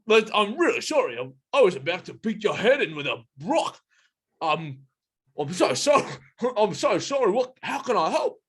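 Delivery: high, flustered gorilla apology; apologetic gorilla. Acted. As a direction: apologise profusely in a put-on high voice. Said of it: "completely shocked out of their anger".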